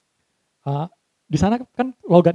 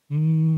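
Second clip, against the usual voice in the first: long steady hum; humming. Shown as a demonstration. Trainer demonstrating a drill against shrill voice, sustained hum. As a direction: hum on one note, buzzing. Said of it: "Cara gini".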